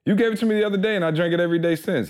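high playful voice quoting his wife; voicing his wife. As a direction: mimic the wife, pitched well up.

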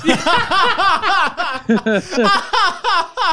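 high, helpless laughter; long laughing fit. Real, not acted. Friend teasing about viral cop videos, long laughing fit. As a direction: burst into sustained high laughter.

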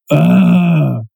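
loud fed-up "ugh"; exasperated groan. Real, not acted. Friend sick of a repeated movie quote, loud groan. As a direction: groan out your irritation.

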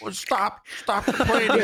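high-pitched whine, half laughing; mock whining protest. Players with the emblem answering complainers, mock whining. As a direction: plead like a put-upon player. Mocking players who defend themselves.

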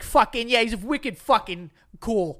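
loud high mock Boston dude; brash Bostonian hype; Boston accent. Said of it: "Boston accent".

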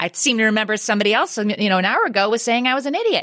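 high, fast sarcastic taunt; mock gloating. Parent to kid who called them an idiot, mock gloating. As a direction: rub it in with put-on indignation.

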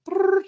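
high, loud trilled whistle noise; mouth-made whistle blast; imitated whistle. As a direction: blow a pretend coach's whistle with your mouth. Mimics whistle stopping practice play.

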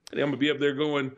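ordinary talk, nothing marked

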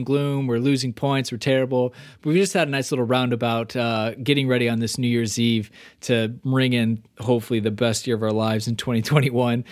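ordinary talk, nothing marked

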